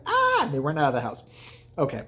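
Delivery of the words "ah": high, loud shriek; hammed-up high yelp; mock scream. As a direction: let out a quick cartoon scream. Kids flee the scene.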